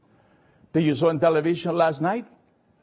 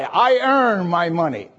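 truck driver. Retold or imitated. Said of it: "talking to a truck driver".

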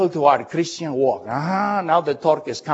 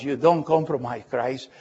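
ordinary talk

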